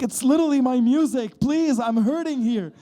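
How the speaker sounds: high, loud, pained plea; mock anguish. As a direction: whine as if in pain, hammed up. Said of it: "And I'm like".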